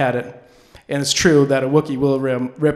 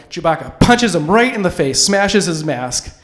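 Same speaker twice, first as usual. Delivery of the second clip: ordinary talk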